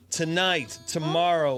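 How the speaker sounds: ordinary talk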